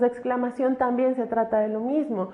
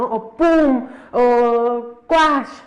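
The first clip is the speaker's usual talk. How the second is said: punchy, louder onomatopoeia; comic sound effects; 5 dB louder, much slower than usual. Shown as a demonstration. Teacher voicing comic-book hit sounds with punch. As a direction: pop them out like comic-book hits. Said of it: "El típico zas".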